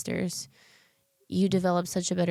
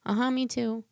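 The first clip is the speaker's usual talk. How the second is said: imitating mom, higher, brushing it off; dismissive mom; 8 semitones higher than usual. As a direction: toss off a higher, offhand mom voice. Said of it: "And you'd be like".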